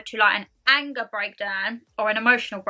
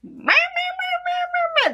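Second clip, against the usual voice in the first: much higher, bleating mock meh meh; slower than usual. Vlogger mimicking her reply to mum, mocking high bleats. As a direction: bleat it back like a sulky child, way up high. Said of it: "And I was like".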